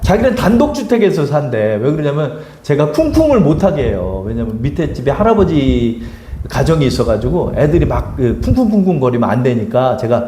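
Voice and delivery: ordinary delivery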